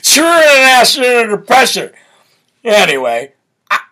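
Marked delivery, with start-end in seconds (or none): 0.0-1.9 louder, higher slurred mumble; lightly hammed-up word salad; mock garbled speech. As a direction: run the words together in mock confusion. Mimicking garbled speech of the clip.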